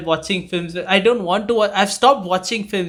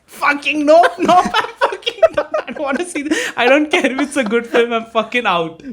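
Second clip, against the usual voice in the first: higher, laughing, half-mock panic; panicked refusal; 7 semitones higher than usual. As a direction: recoil from the screen, laughing in fright. Retelling fright at horror film.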